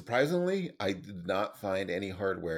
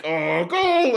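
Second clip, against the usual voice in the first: loud, high mock goal shout; excited goal call; 12 semitones higher, 13 dB louder than usual. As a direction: yell the goal like a fan. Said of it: "And then I'm like".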